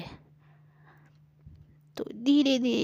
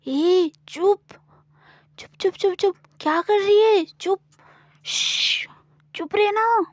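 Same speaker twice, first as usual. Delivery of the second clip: higher, louder panicked hush of a stranger; urgent hushing; 9 semitones higher, 6 dB louder than usual. Acted. A stranger clamps Gauri's mouth, urgently hushing her.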